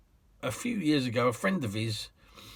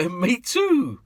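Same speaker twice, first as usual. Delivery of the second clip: high, loud, eager Me too; bright echo of agreement; eager reply; 9 semitones higher, 6 dB louder than usual. Retold or imitated. Graham Norton eagerly telling the cabbie him too.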